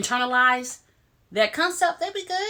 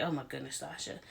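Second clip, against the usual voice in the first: much softer and lower, in disbelief; hushed; 7 semitones lower, 15 dB quieter than usual. Retold or imitated. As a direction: breathe "oh my goodness" under your breath. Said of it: "I'm like".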